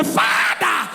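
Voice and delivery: hammed-up congregational praying; fervent mock prayer. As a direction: cry out to the Father, drawing each word out. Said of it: "You are going to pray".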